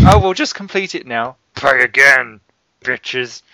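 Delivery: smug publisher boss voice; greedy corporate exec. Acted. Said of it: "that voice you did there, you sound like the chairman of E A".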